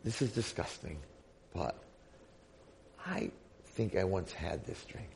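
hushed, halting words with long pauses; soft, hesitant acted remembering; dawning recollection. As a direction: feel your way into a faint memory. Drinker slowly recalls a lost memory.

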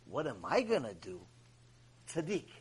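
low, soft private musing; hushed self-questioning; talking to himself. Retold or imitated. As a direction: drop low and quiet, thinking aloud. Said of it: "then I said to myself".

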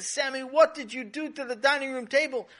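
shocked mother yelling at her son; shocked outburst. Acted. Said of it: "She backed up suddenly".

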